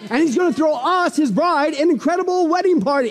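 high, insistent declaration; full-force preaching. Real, not acted. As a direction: preach it urgently, pushing toward a climax.

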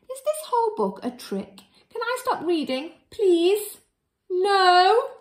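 high, whiny hammed-up begging; mock pleading. Reader begging the children to stop, high whine. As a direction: beg to stop, squeaky and desperate.

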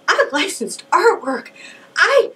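high, frantic mock meltdown; hammed-up panic on the phone with mom; coming apart. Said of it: "just coming apart".

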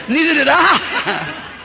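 slow, high, chuckling through the words; laughing retort. Retold or imitated. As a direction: laugh as you drawl out the answer. Retold bear joke, laughing.

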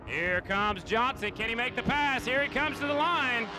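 building to a high, loud finish call; rising excitement as they hit the line; excited race call. Real, not acted.

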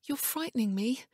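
high-pitched, fearful female character; frightened. A narrator's reading. Wife telling Ian he frightens her, frightened. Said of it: "fear in her eyes".

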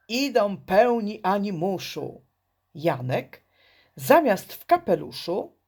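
plain reading voice

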